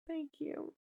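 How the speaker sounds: real choked, voiceless thanks through tears; tearful. Real, not acted. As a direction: squeeze the words out while crying. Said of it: "I'm gonna cry".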